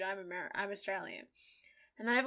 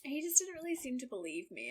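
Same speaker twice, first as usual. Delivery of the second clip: usual speaking voice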